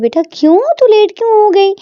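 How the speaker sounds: a father alarmed by the news; concerned father. Acted.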